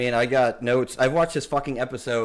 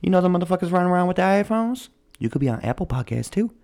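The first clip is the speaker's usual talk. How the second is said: higher, fast, put-on salesman voice; voicing Anchor; 6 semitones higher, 3 dB louder, faster than usual. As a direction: slip the deal over like a street hustler. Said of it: "He's like".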